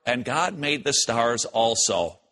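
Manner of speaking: low, slow throwaway remark; parenthetical aside. Acted. Preacher quoting Genesis on the stars, offhand aside. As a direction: drop it in low, like no big deal. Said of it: "just kind of a little parenthetical remark".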